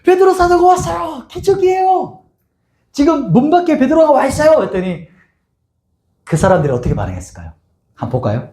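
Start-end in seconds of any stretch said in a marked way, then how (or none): 0.0-4.6 put-on excited girl. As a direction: run in shouting the good news. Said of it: "그래서 사람들한테".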